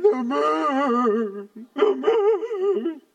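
put-on high, loud, crying wail of a scared little girl; imitating crying child. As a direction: cry out the words like a frightened granddaughter. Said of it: "But she's crying".

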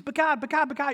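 high whiny but God; pleading excuses. Retold or imitated. Preacher acting his excuses to God, high pleading whine. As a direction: protest like a reluctant kid. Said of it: "I wanna give excuses".

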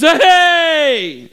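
high, hammed-up cheer; crowd cheer. Townspeople cheer the Bible student, hammed-up cheer. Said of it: "everyone goes".

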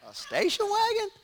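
put-on dismayed kid, rising high on "wagon"; mock kid dismay. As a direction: let "a station wagon?" climb high in horror. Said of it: "I'm like".

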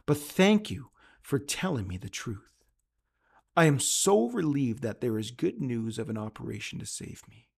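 low, soft and humble; humble patient thanking the doctor, quietly. A narrator's reading. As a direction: speak softly, grateful and shaken. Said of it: "he sat there quietly for a moment".

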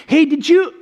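high, loud re-enacted blurt; sudden blurt. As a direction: burst in eagerly out of nowhere. Couple blurting out at once.

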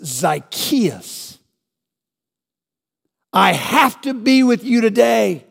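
Jesus calling up into the tree, grinning; Jesus with a grin. Retold or imitated. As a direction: call him down with a smile in the voice. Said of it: "I would imagine he said it with a grin".

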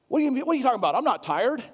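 put-on confused old man taking offence; hammed-up indignant denial; imitating an indignant patient. Patient quoted denying he's tired.

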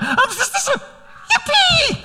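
put-on very high, stretched-out joyful kid's shout; high, slow, laughing yippee; child's gleeful yippee. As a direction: squeal with a little boy's relief. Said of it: "He literally went".

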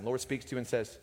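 ordinary delivery

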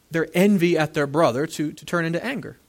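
ordinary delivery

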